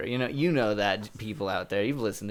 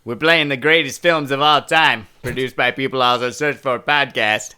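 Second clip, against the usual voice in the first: hyped-up announcer; 3 semitones higher, 10 dB louder than usual. Pretend. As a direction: blast it out like a show opener. Mock radio promo over own noises.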